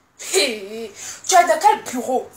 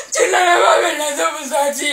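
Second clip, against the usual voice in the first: wailing lament. Acted. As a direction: wail it like a mourning song.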